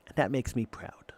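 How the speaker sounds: whispered aside of pride; whispered, proud and private. Retold or imitated. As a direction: drop to a breathy whisper. Whispered inside defiant reply.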